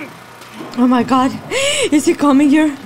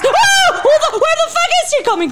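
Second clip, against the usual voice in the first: high, fast frightened yelling; real startled panic; panicked shout; 11 semitones higher, faster than usual. Real, not acted. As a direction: rattle it off in alarm. Surprised by an enemy.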